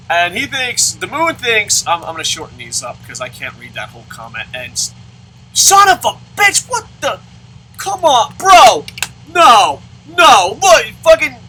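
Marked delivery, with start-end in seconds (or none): 5.5-11.4 high, loud raging yell; real rage at the game's fielders; furious outburst. Real, not acted. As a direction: blow up at the bad play. Raging at game play.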